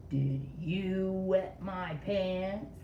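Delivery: YOU drawn out with a rising question; stress on YOU. Shown as a demonstration. Reading the bright word to kids, stressing you. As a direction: lean on the word you, slow. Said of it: "I said it a little differently".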